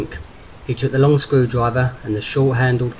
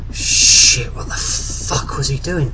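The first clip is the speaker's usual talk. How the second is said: drawn-out, hissed curse; appalled self-reproach; 2 semitones higher, 4 dB louder, much slower than usual. A narrator's reading. Derek doubting himself as the helicopter nears, slow appalled cursing. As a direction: stretch the swearing out in dismay. Character's alarmed thought as helicopter comes.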